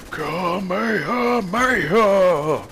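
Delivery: long, drawn-out Kamehameha battle cry, high and loud; Kamehameha shout. Retold or imitated. Mimicking the sentry bot firing a blast at him, drawn-out anime battle cry. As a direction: roar it out slow like an anime energy blast. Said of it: "He was like".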